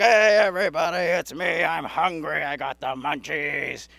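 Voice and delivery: hammed-up mascot greeting the crowd; stomach mascot. Stomach greets a stoner comedy club, hammed-up mascot call. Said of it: "go up with the stomach and be like".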